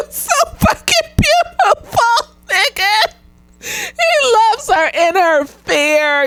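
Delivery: high, tearful, slowed voice; crying-laughing. Real, not acted. Moved by the father on screen, tearful laughing voice. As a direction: choke up while laughing through it.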